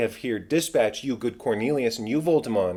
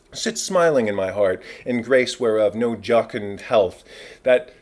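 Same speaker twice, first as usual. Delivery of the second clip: loud, ringing kingly declaration; royal proclamation; 5 dB louder than usual. Acted. King to his court, announcing cannon for the toast, loud and ringing.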